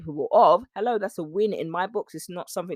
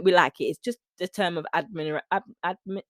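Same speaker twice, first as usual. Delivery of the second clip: ordinary talk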